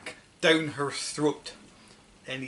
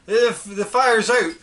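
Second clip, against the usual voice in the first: higher, louder stammering announcement; proud boy; 7 semitones higher, 8 dB louder than usual. Acted. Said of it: "George announced proudly".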